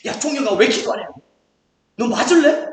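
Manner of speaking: loud scolding, pitch climbing at the end; mock threatening. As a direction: threaten like an exaggerated bully. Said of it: "막 이렇게 제가 협박하고".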